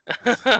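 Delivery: high, loud quick laugh; short laugh. Real, not acted. After telling the dad story, short laugh. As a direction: chuckle at the memory.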